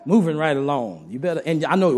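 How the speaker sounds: normal talking voice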